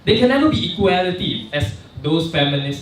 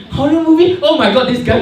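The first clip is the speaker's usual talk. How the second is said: high, loud incredulous question; shocked disbelief; 11 semitones higher, 8 dB louder than usual. Pretend. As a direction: gasp it out in disbelief. Mock audience reaction.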